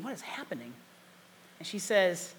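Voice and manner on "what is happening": nervous inner voice. Retold or imitated. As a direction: mutter in shock to yourself.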